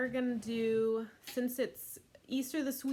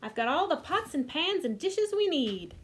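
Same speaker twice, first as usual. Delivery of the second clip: put-on higher, eager girl voice; excited girl; 6 semitones higher, 5 dB louder than usual. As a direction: show off the toys eagerly. Voicing child character.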